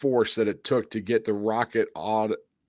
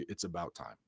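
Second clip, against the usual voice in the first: hushed mentor voice; 5 semitones lower, 14 dB quieter than usual. Retold or imitated. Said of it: "he said".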